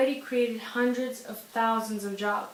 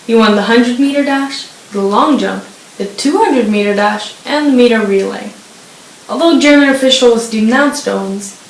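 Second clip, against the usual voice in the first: much louder, booming announcer read; loud news read; 16 dB louder than usual. Acted. Anchor honoring Jesse Owens, booming loud. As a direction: project it like a stadium announcer. Reading Olympics news segment.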